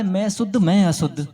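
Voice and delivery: loud mock cry of the quarrelling people; mocking shout. Monk mocking people who shout about purity, loud. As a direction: shout it to ridicule their bickering. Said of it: "काय को चिल्ला रहे".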